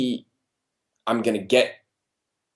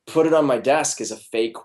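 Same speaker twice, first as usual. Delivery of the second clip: normal talking voice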